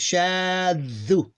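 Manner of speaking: "shaddu" with long vowel and held middle consonant; sustained, unbroken demo of "shaddu"; blended without stopping. Shown as a demonstration. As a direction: draw out "shaddu" with no gap between syllables. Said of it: "to two sound units".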